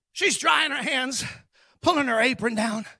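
ordinary talk

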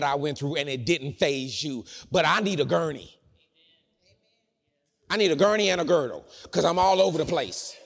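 loud, high, comic plea for help; full-force preaching. Real, not acted. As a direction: cry out the plea at preaching volume.

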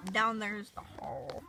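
hushed whisper in the dark. Real, not acted. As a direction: whisper it softly. Whispering inside the fort.

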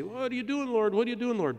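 high-pitched, protesting question; mock complaint to God. Preacher voicing those who don't understand God, mock complaint. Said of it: "You say".

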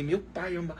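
normal talking voice

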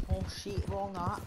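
ordinary talk, nothing marked

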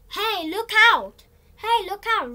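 loud high shout of warning. Acted. Window cleaner teaching Willy what to shout, loud. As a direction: yell to stop a crash.

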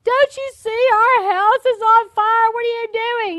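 acting out a distraught wife's appeal; mock wail of alarm at an oblivious husband; imitated crying wife. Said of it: "and she's crying. She's like".

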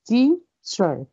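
"teacher" split into "tea", pause, "cher"; syllable by syllable. Shown as a demonstration. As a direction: break "teacher" into two separate beats. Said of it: "Two syllables".

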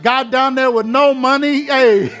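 high, loud preaching climax; full-force preaching. Acted. Preacher praising how Sherm led the brother to God, full-force preaching. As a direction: ride the wave of praise.

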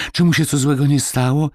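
put-on high, worried girl's question; a sick girl fearing for her dog; anxious little girl. A narrator's reading. Marysia worried about dog.

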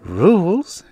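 high, airy dismissal with a lift; acted breezy unconcern; light, breezy. A narrator's reading. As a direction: toss it back lightly. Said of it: "Janie answered lightly".

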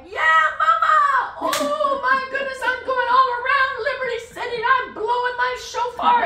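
loud, high, jubilant holler; imitated excited shouting. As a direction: shout the news joyfully across a field. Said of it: "And he's like".

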